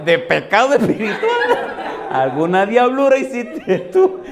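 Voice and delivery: teasing rebuke with a laugh; laughing scold. Retold or imitated. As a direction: scold the backslider half laughing.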